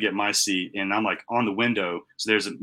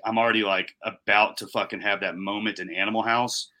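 ordinary talk

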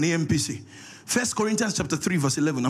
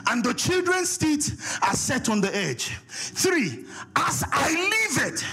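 preaching; 9 semitones higher, slower than usual. Acted. Preacher reads scripture aloud.

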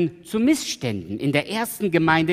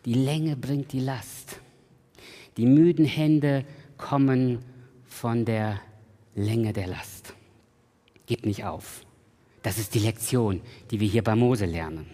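quiet sermon refrain; 5 semitones lower, 4 dB quieter than usual. Acted.

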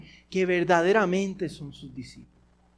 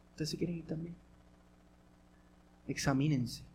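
much softer, inward, inviting self-examination; soft searching question; 6 semitones lower, 12 dB quieter than usual. Shown as a demonstration. Jesus gently asking the disciples to examine themselves, soft and searching. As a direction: ask it gently so each one examines himself. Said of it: "escuchen la pregunta otra vez, pero mirándola de esa manera".

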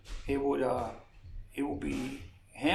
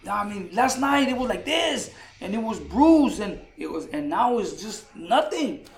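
amazed retelling; 6 semitones higher, 6 dB louder than usual. Retold or imitated. Fighter amazed at the healed cut.

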